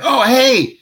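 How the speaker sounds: high-pitched, chirpy imitation of a fan; eager fan. Fans asking the actor about the donut, eagerly. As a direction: imitate fans calling out for the story.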